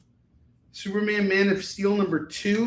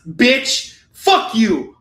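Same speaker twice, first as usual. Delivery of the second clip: loud, high insult; hammed-up indignant shout; angry comic rant; 6 semitones higher, 11 dB louder, much slower than usual. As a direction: hurl it at the fussy coach. Mock rant at etiquette coach.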